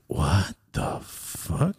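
very slow, hushed disbelief; whispered, drawn-out retold shock; stunned whisper. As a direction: stretch it out under the breath. Repeats stunned reaction, hushed.

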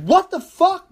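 high, loud startled yelp; startled shout. Retold or imitated. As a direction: blurt it out, shocked. Friend clotheslines attacker.

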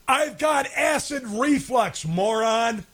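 high, drawn-out exasperated comeback; indignant retort. Retold or imitated. Host retorting to accusing woman at store, indignant. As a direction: throw it back slowly, pitched right up.

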